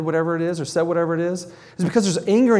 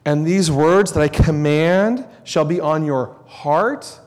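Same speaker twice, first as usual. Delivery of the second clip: preacher intoning Deuteronomy to the congregation; solemn scripture reading; 4 dB louder than usual. Shown as a demonstration. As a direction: read it out like a sacred proclamation. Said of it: "Deuteronomy six, verse four".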